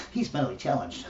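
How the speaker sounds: hushed, low euphemism; mock whisper.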